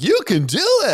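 high condescending cheer; sing-song patronizing encouragement; mocking cheer. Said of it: "I didn't use that voice".

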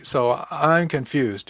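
slow, puzzled admission; mildly baffled, drawn out; confused. A narrator's reading. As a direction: work it out slowly, at a loss. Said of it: "recovered enough to say".

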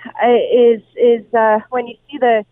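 halting, with repeated words and fillers; real stuttering. Real, not acted. Reporter at the tornado scene struggling to describe it, stuttering. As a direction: stumble and restart, searching for words. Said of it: "I'm sorry, I'm stuttering".

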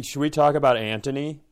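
ordinary talk, nothing marked

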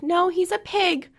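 high, slow imitation of a small child; mimicked child's high matter-of-fact reply; little kid voice. Voicing four-year-old's answer.

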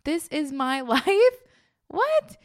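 loud, high, incredulous delight; acted overjoyed astonishment; so excited. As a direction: draw it out, then squeal in disbelief. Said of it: "he gets so excited. It's like".

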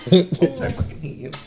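soft, through real laughter; laughing. Real, not acted. Laughs as host hugs him.

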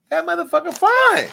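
sudden high squeal of delight; impressed squeal. Real, not acted. Host reacting to the officer's photo, high swooning squeal. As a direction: pitch jumps way up, swooning over him.

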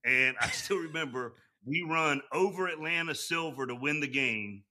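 normal talking voice

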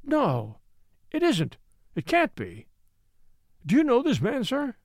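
high, startled disbelief; a servant recoiling from a severed head, high and shaken; shocked gasping. A narrator's reading. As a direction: gasp it out in shock. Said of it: "he gasped".

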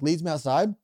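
normal talking voice

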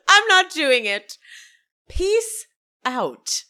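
high, loud mock diva refusal; theatrical huffy exit line; Meredith. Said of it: "And she goes".

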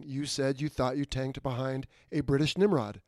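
ordinary delivery

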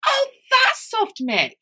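slow, drawn-out high mock realisation. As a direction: act out the penny dropping, slowly. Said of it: "turn around and go".

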